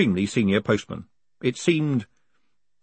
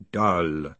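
flat, lower blank repeat; blank golem voice; 5 semitones lower, 3 dB quieter than usual. Acted. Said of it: "said Anghammarad blankly".

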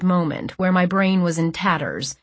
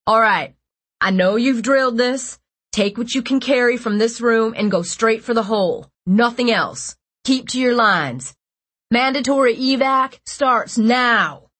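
raised commanding voice, slowing at the end; shouting orders; 4 semitones higher than usual. Acted. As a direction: call the evacuation over a crowd. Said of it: "shouting over the panicked whispering".

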